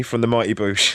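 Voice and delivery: normal talking voice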